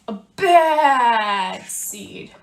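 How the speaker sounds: slow, heavy bad seed voice; gruff bad seed. Teacher reading to kids as the bad seed, slow and heavy. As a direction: drag it out like a grumpy seed.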